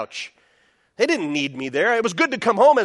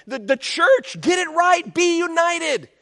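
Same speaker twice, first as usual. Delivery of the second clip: urgent Paul; 7 semitones higher than usual. Retold or imitated. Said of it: "Paul's saying".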